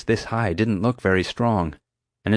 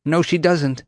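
higher, insistent voice; raised-pitch quick rebuttal; urgent retort; 8 semitones higher, 3 dB louder, slower than usual. A narrator's reading. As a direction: contradict firmly in the rush. Urgent retort to the panicked yell.

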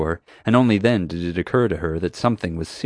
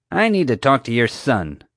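higher, brusque demand to be heard; rude interruption; 5 semitones higher, 4 dB louder than usual. A narrator's reading. Guard cutting in on mom to get at her son, rude. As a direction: cut in rudely. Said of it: "Marge interrupted rudely".

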